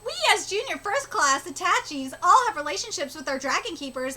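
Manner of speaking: ordinary talk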